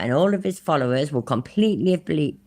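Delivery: ordinary talk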